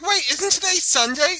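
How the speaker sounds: high mock surprised question; imitating Jen. Teasing the cohost by imitating her confusion, high and surprised. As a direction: play her as suddenly puzzled. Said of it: "You're like".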